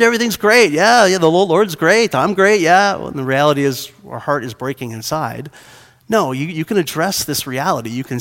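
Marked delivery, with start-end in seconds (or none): 0.0-3.0 higher, louder mock-cheerful gushing; pretend everything's great. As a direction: put on a loud, over-eager show that all is well. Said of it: "where we just pretend".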